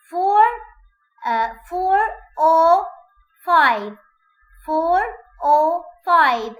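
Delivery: "four oh five" with an r joining "four" to "oh", high and loud; linking r in four oh five. Shown as a demonstration. Teacher drills four oh five, high and loud. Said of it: "I have linking re".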